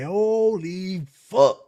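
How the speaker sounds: loud, very drawn-out exclamation of disbelief; acted slow-motion astonishment; retold shock. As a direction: stretch it out high and loud, stunned. Retold shock at hearing the crow.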